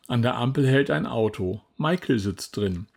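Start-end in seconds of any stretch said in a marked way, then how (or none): none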